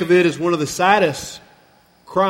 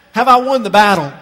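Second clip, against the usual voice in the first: mock triumphant question; 3 semitones higher, 6 dB louder, faster than usual. Shown as a demonstration. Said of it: "Notice he doesn't ask".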